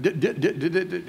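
rapid-fire stutter on DID. Shown as a demonstration. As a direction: repeat the syllable like a stuck stammer. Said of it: "he didn't stutter like that".

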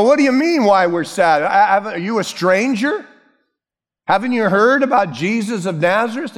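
high-pitched acted disbelief; mock incredulous. Preacher voices the walkers quizzing a stranger, high and incredulous. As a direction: question him as if he must be a stranger.

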